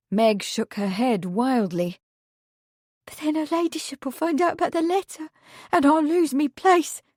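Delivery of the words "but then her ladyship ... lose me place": put-on fast, higher panicked girl. A narrator's reading. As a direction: rush it in a panic. Meg panicked.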